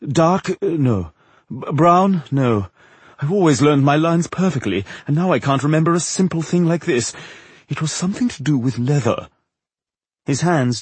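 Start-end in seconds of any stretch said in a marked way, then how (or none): 0.1-9.3 theatrical, higher voice groping for a word; frustrated impresario. A narrator's reading. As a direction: rack your memory aloud, dramatically.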